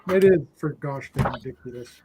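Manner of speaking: ordinary delivery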